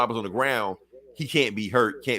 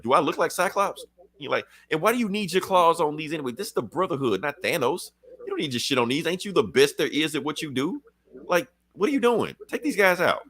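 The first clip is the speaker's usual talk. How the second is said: higher, sassy scolding voice; imitating a fed-up Storm; 6 semitones higher than usual. Storm telling off Wolverine in battle, high sassy voice. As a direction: talk down to a stubborn teammate.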